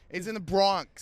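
put-on loud, high Bronx accent. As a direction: belt it in a thick Bronx accent. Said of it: "That's about all I can do with a Bronx accent".

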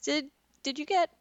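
high-pitched, hesitant questions; imagined incredulous questioner. Pretend. Asking a broke billionaire what happened, high and hesitant. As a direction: tentatively ask in a high voice. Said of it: "would be like".

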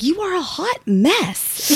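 high, slow mock verdict; playful impression of an exasperated partner; imitating husband. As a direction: draw it out high, teasing yourself through his words. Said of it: "He's like".

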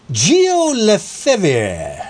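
slow, drawn-out mock French accent on the name; lightly hammed French pronunciation of Gio Lefebvre; bad French accent. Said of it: "that's not a very good French accent".

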